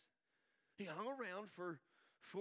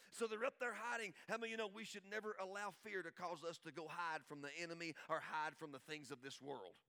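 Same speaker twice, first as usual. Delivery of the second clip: normal talking voice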